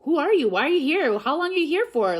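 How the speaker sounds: loud, high, hammed-up nosy questioning; nosy Canadians. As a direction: imitate pushy, overeager interrogators. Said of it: "every single Canadian's like".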